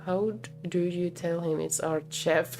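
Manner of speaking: ordinary talk, nothing marked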